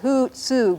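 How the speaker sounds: hutsu slow, both U's held; long U's drawn out. Shown as a demonstration. Teacher repeats this word, hutsu with both U's long. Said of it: "You draw them both out".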